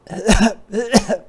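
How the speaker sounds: loud, high cub cough; acted cough. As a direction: cough like a sick little bear.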